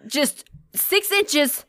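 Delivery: high, strained begging, acted out; exasperated pleading. Owner begging a cat just out of reach, exasperated pleading. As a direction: plead slowly, then pitch it up in exasperation.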